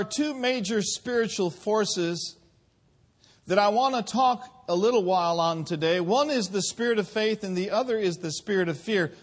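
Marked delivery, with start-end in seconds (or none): none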